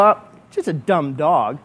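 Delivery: low, slow, unimpressed scoff; dismissive. Pretend. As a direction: brush the gift off with disdain. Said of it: "and I go".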